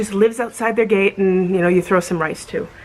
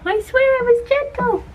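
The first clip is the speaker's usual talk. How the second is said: even higher, squeakier plea. Real, not acted. Pleading very high to the puppy mad about the vaccine.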